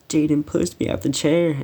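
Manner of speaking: high-pitched imitation of a crying tattletale; mock crying. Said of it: "he sees I'm crying".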